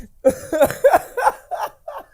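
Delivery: high-pitched laughing fit; long, high burst of laughter. Real, not acted. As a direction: crack up helplessly. Laughing at the joke.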